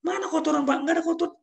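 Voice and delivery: very high protesting reply; a worker who can't see it; staff denying. Retold or imitated. Staff denies dirt to boss.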